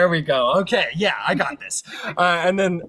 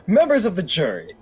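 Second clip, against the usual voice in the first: grand opening to the jury; mock-grand lawyer to the jury; courtroom address; 2 semitones higher than usual. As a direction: start a grand speech to the jury. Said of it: "I can't believe I'm doing this".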